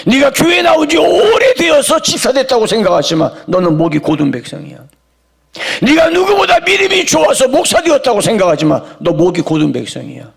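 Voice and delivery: high shouted charge, then the verdict dropped plain; fiery preaching. Acted. Preacher hurling God's charge at the congregation, shouted. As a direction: preach it at full force, then land the verdict.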